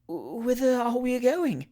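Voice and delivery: slow, high tragic lament; tragically. Acted. Julia lost in the night chaise, slow tragic lament. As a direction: ask it like a heroine in despair. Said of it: "inquired the lady tragically".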